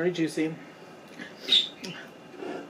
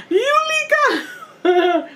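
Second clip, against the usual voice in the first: high, drawn-out Hungarian mother calling, laughing; Hungarian mom accent; 9 dB louder, much slower than usual. Retold or imitated. Late mom calling her daughter's name in a Hungarian accent. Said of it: "In her little Hungarian accent".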